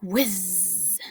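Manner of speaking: usual speaking voice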